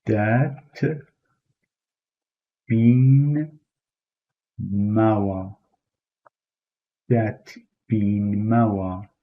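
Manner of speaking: "dat bin mawa" word by word, then slowly together; Kurdish see you. Shown as a demonstration. Teacher models Kurdish see you slowly. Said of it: "in Kurdish would be".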